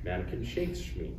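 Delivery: ordinary talk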